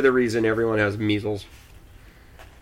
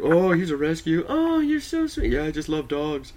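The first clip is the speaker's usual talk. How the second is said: high, gushing fangirl voice; cooing woman; 6 semitones higher than usual. Retold or imitated. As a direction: coo over him sweetly. Mocking women cooing over dog.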